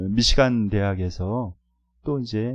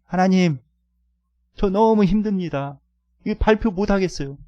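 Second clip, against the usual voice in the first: higher, pleading cry to God, half acted; crying-out prayer; 7 semitones higher, 3 dB louder than usual. Student praying on the field the night before, a retold pleading cry. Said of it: "막 부르짖으면서, 어, 이렇게 기도했습니다".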